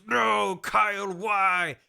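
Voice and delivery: high, drawn-out mock cry of grief; mock anguish. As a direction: wail slowly in hammed-up despair. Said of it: "And Snake goes".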